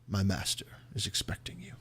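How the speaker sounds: soft, hushed elf servant voice. Acted. Elf greets the visitors for his master, hushed. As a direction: deliver it quietly, almost whispered, with a slight lift at the end. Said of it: "He looks at you and he says".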